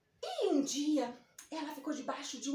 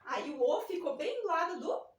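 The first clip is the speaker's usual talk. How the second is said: usual speaking voice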